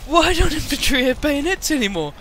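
loud, high outrage; indignant yell. Real, not acted. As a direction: rant at the game loudly. Indignant outburst about bayonets.

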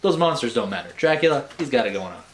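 usual speaking voice

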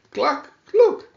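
high, loud hen call; crying hen cluck. A narrator's reading. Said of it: "she cried".

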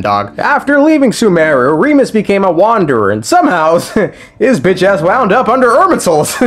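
normal talking voice

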